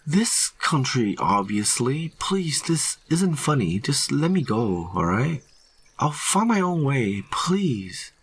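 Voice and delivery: high, anxious begging; plead, falling away on the last please; desperate pleading. A narrator's reading. Hiker begging rangers to release him, high and frightened.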